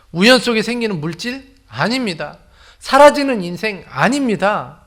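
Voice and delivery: loud, high impassioned preaching; preaching at full force. Acted. Pastor proclaiming to the congregation, loud and fervent.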